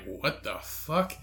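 soft, slow retold disbelief; quietly baffled. Man watching the lady walk by, quietly bewildered. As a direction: mutter it slowly, as if to yourself. Baffled reaction to cursing stranger.

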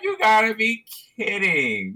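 high-pitched mock Australian exclamation; Australian accent. Manager reacting to the paper towel fight, high mock Australian accent. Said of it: "Is that your Australian accent?".

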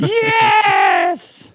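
very high, loud, long-held scream of joy; retold elated shout. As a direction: let out a huge triumphant yell. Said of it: "I was like".

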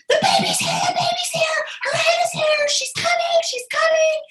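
urgent, repeated high-pitched cries; mock screaming. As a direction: re-enact a scream at reduced volume. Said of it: "I started screaming to her".

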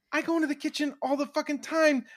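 high, fast exasperated whine. Retold or imitated. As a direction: rattle it off, fed up and high. Exasperated inner voice rant.

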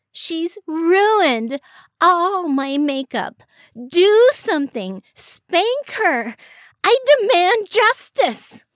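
shrieking tantrum, high and loud; fuming with rage. A narrator's reading. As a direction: demand punishment at the top of your voice. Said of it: "Iris was fuming with rage".

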